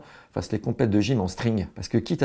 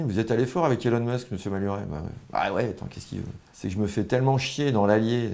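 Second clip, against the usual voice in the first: normal talking voice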